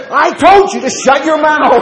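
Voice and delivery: high, slow, hammed-up shout; furious outburst. Retelling preacher silencing his visitor, comic furious shout. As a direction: bellow it, drawing out every word.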